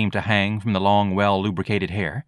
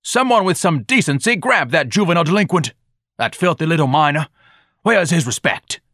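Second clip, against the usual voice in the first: high, loud indignant tirade; wild pompous ranting; 9 semitones higher, 5 dB louder than usual. A narrator's reading. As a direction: call on the crowd in outrage. Ignatius rants after fleeing boy.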